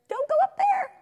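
very high, loud mock panic; squeaky alarmed salamander voice; alarmed warning. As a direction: cry out a frantic warning. Voicing the alarmed salamander's thought.

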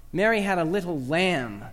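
"lamb" stretched and weighted at the end; stress on LAMB. Shown as a demonstration. Teacher showing what Mary possessed, stress on "lamb". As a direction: save the emphasis for "lamb". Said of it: "Emphasize the fifth word".

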